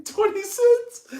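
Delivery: very high, slow rising disbelief; incredulous. Real, not acted. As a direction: stretch it out in amused shock. Amused disbelief at currency.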